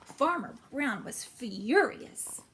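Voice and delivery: ordinary delivery, nothing marked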